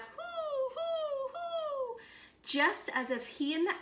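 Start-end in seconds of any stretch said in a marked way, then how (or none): 0.0-2.0 high hooting owl call; long, high, hollow owl hoots. Acted. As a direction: hoot like a great horned owl. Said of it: "Then he called back".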